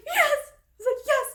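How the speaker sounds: very high, loud squeal of yes; excited cheer. Retold or imitated. Reader reacting to the ugly dress hint, excited cheer.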